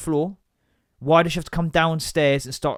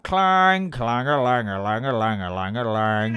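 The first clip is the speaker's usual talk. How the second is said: muffled bottle clanging sounds; sing-song clang noises; clanging imitation; 4 semitones lower, slower than usual. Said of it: "muffled bottle clanging sound".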